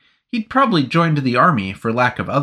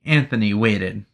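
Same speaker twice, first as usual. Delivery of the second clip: plain reading voice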